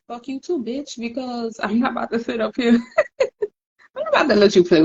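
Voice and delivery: a giggling kiss-off; laughing dismissal. Real, not acted. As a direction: laugh it off while cursing them out.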